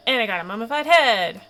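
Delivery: loud, triumphant exclamation; triumphant cheer. Real, not acted. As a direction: call out your win loudly. Celebrates success in the game.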